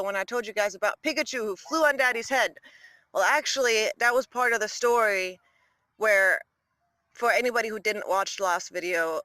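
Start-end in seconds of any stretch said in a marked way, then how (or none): none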